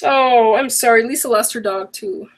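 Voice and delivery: ordinary talk, nothing marked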